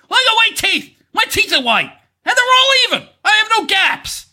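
high, hammed-up preening; exaggerated self-admiring boast; mock vanity. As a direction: show off in a high mocking voice.

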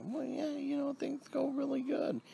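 high, half-whispered mocking mother; mimicking mother. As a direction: squeak out her meek answer. Son mimics mother's reply.

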